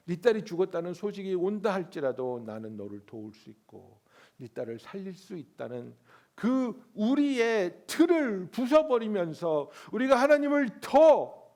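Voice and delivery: preaching at full force. Real, not acted. Preacher proclaiming to the congregation, full-force. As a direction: build it to a thundering climax.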